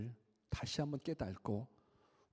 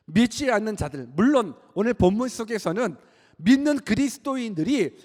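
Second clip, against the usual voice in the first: high, loud pulpit cry; impassioned sermon, voice pushed up; full-force preaching; 12 semitones higher, 11 dB louder than usual. Acted. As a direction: preach with mounting fervor.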